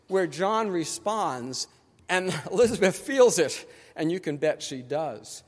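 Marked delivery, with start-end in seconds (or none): none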